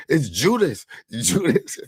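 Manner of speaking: high-pitched acted announcement, amused; mock exclamation. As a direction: call out the traitor's name in mock alarm. Acted discovery of a traitor.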